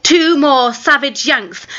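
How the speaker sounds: plain reading voice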